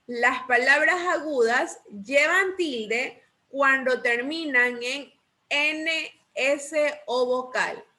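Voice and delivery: slow, measured dictation with spaced words; slow rule recital. Shown as a demonstration. Teacher repeats the accent rule for the class, slow and measured. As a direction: recite it slowly, pausing between phrases. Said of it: "Repitamos esta regla".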